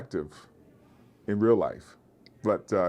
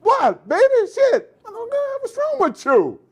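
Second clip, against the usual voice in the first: much higher and louder exaggerated caricature; 8 dB louder than usual. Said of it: "they want".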